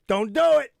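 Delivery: a warning, loud and high; stern wife warning. Retold or imitated. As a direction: tell him firmly not to. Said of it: "my wife says".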